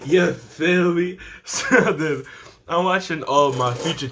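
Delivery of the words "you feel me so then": slow amused laugh through words; chuckling while asking. Real, not acted. Laughing at own joke.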